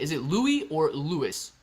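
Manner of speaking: first Louis as LOO-ee, second Louis as LOO-iss; two pronunciations compared. Shown as a demonstration. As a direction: slowly offer Louis without the s, then Louis with it. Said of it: "I don't know how to say it".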